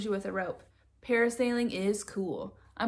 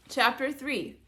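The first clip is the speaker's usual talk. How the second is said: ordinary delivery, nothing marked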